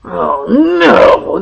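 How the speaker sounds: normal talking voice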